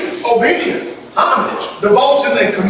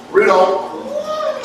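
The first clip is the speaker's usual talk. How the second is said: usual speaking voice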